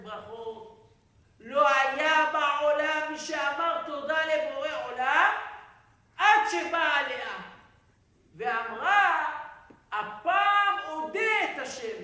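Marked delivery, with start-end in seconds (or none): 1.4-12.0 slow, loud, high proclamation; drawn-out booming sermon delivery; preaching at full force. Acted. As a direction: declaim the sacred quote to the hall. Preacher declaims Talmud quote.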